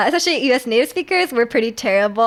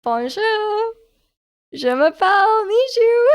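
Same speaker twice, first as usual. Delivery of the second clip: high, singsong beginner French; French phrasebook recital in a high lilt; beginner's French; 9 semitones higher, slower than usual. Shown as a demonstration. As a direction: show off tiny French, half laughing. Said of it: "I could say, like".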